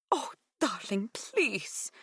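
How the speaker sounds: lightly voiced Greek mother; Greek woman voice. Maria voiced.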